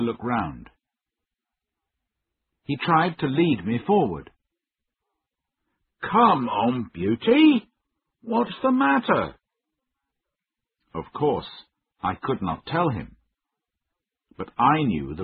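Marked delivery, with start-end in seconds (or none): 6.0-9.3 high, raised call to a horse; puzzled, high-pitched coaxing call; urging call. A narrator's reading. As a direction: urge a balking horse, pitch climbing high. Coaxing the horse that won't move.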